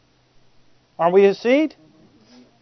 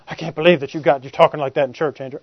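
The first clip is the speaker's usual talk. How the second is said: disapproving churchgoer; 6 semitones lower, 4 dB quieter than usual. Retold or imitated. Voices a disapproving church member.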